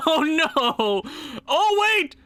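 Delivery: high-pitched, startled exclamation; alarmed surprise. Real, not acted. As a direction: leap up in pitch with sudden alarm. Startled by the trade news.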